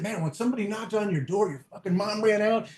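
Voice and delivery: ordinary talk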